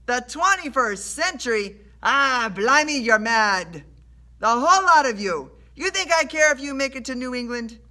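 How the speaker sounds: loud, high, jeering sailor voice; scornful sailor. A narrator's reading. Sailor mocks Rush's claim, loud scorn. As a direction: scoff loudly like a disbelieving sailor.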